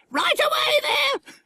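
very high, drawn-out squawked shout; piercingly high, stretched call; parrot mimicking guard. A narrator's reading. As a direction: holler like a parrot playing station guard. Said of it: "just as she'd heard a guard say".

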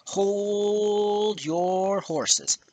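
very drawn-out, high "Hoooold your horses"; exaggerated drawl. Real, not acted. Telling Iwata to wait, exaggerated drawl. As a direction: stretch HOLD and YOUR enormously.

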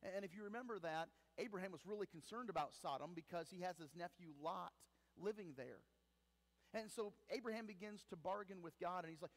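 ordinary talk

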